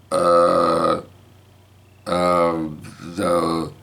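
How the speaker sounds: long drawn-out stalling fillers; stumbling over words. Retold or imitated. Grandpa caught out by granddaughter, stumbling and stalling. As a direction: stall haltingly, hunting for words. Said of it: "I'm stumbling over my words. I don't know what to say".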